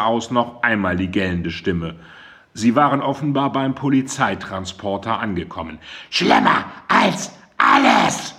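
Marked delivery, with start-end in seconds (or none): 6.1-8.3 slow, hoarse, unvoiced scream; rasping shriek. A narrator's reading. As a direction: rasp it out from far off. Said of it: "kreischte Mandy noch einmal".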